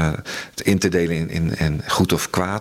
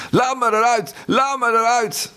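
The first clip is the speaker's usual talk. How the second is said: high, strained shrieking repeated; trapped prisoner screaming in a cattle wagon; desperate scream. A narrator's reading. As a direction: beg wildly to be let out. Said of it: "schreeuwde hij".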